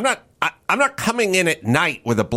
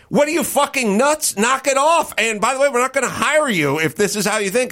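high-pitched indignant outburst, imitating fed-up people; exasperated mock telling-off; 7 semitones higher than usual. Fed-up public telling off the activists, mock indignant. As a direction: scold them in disbelief, voice pitched well up.

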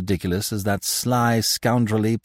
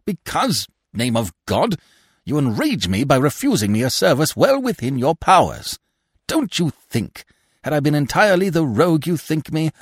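higher, louder exasperated outburst; enraged; 9 semitones higher, 5 dB louder than usual. A narrator's reading. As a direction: let the anger boil over. Binet enraged by refusal.

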